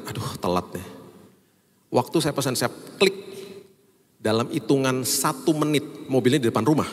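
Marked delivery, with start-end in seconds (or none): 0.1-0.9 inner thought. Retold or imitated. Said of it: "saya dalam hati".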